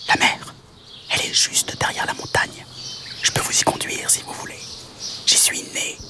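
whispered insect voice; all breath, no voice; tiny mantis. Acted. Mantis offers to guide the troop, whispered. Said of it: "proposa la mante".

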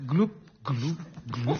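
gloup gloup plus bas et plus doux; bulles qui s'éteignent. Pretend. Le train sombre, fin. (dernières bulles, plus bas et plus doux)

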